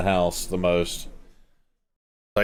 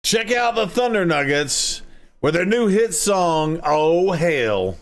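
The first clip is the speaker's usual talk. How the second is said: announcer voice; 6 semitones higher, slower than usual. Pretend. Band announcer intro.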